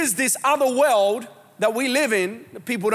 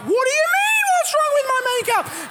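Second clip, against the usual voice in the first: much higher, offended comeback; mock indignant reply. Preacher plays the offended spouse answering in the car. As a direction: squeal back in outrage.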